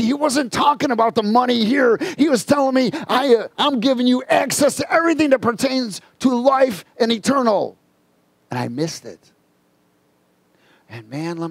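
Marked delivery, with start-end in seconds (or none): 0.0-7.8 high, forceful preacher voice; fervent revelation from the pulpit; impassioned preaching. Real, not acted. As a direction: drive the point home with raised, urgent pitch.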